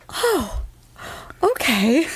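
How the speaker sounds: high, halting retold reaction; a small high "oh", pause, then agree; high coy acceptance. Shopper answering his offer of bread, high hesitant acceptance.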